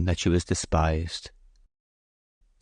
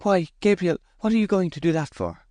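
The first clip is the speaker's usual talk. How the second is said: much higher, pleading question; dismayed surprise; 12 semitones higher than usual. A narrator's reading. Bathsheba asks Gabriel why he will leave, dismayed. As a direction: ask why in disbelief. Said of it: "in surprise and genuine disappointment".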